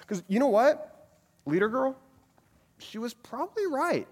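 a hint of a sassy girl; girl's voice. Voicing a girl in a story.

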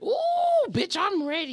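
high, loud hyped squeal; excited shout. Retold or imitated. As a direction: shriek it out with pure hype. Hyped reaction as song starts.

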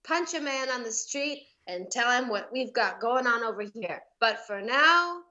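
slow, drawn-out list of plugs; podcast intro. Acted. Guest plugging the show from memory, announcer style. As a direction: announce each item separately, stretching the words.

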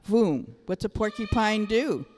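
ordinary delivery

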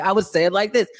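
ordinary delivery

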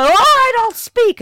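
high, loud mock confession; suspect giving in. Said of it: "you go".

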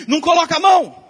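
high, loud warning yell; alarmed shout. Retold or imitated. Wife shouting at son not to touch the cardboard, loud alarm. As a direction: yell it to stop a hand in time. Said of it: "daí a Laura gritou assim".

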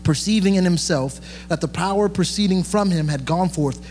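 ordinary talk

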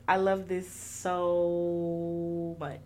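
long drawn-out SO; gushing. Real, not acted. Fan gushing over [name] versus, SO held long. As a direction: stretch SO way out, slowly.

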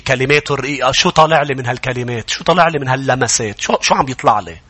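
ordinary talk

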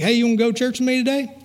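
high, quick eager invitation; chirpy, fast church invite; Gomer Pyle. Retold or imitated. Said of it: "He was Gomer Pyle".

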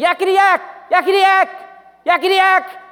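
high, loud yakety yak chatter; mock the pesky little monkey demon; shrill taunting chatter. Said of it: "started saying".

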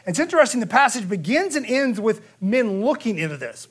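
ordinary talk, nothing marked

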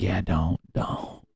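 soft nagging inner voice; little inner voice. Retold or imitated. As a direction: murmur it like the voice in your head talking you out of it. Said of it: "that little voice in my head was like".

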